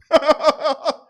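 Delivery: high, loud burst of laughter; derisive laugh. Real, not acted. Host mocking the coach's play call, loud laugh. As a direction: laugh at the absurdity.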